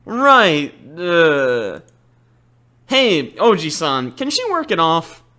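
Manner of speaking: ordinary talk, nothing marked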